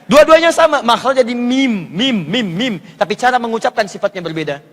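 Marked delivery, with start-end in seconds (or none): 1.3-2.7 "mim" drawn out, closing both lips; lips meeting. Shown as a demonstration. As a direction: articulation demo of "mim" with a held hum. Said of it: "Bertemu bibir atas dengan bawah".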